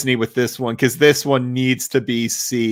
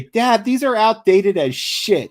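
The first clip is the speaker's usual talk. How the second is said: put-on higher-pitched, drawn-out kid's gripe; mock child's complaint, pitched up; imitated complaining kid; 8 semitones higher, slower than usual. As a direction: imitate your kid griping to Dad, slowly. Voicing his children's complaint.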